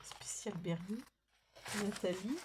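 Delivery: soft whisper of the label. Real, not acted. Reading the sticker book label to viewers in a whisper. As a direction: breathe the name out secretly.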